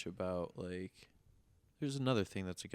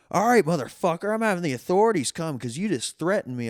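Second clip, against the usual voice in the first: much higher, louder mock outrage; caller threatening back; 9 semitones higher, 10 dB louder than usual. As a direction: bark it as the furious caller. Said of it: "he follows it up with".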